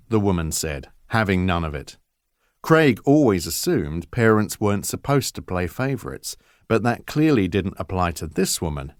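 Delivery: ordinary delivery, nothing marked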